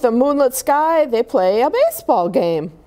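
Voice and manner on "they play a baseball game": ordinary delivery, nothing marked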